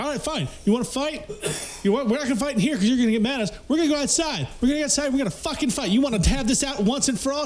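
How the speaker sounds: high, fast mock-bravado; egging the guy on to fight; cocky challenge. Narrator mocking his younger self challenging the guy, high voice.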